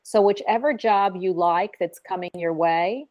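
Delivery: ordinary talk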